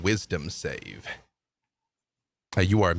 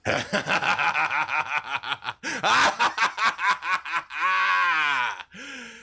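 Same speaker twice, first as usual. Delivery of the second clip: evil laugh; 7 semitones higher than usual. Acted. Villain laughs at the heroes.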